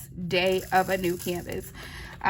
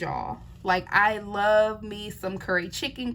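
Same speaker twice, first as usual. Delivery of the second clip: normal talking voice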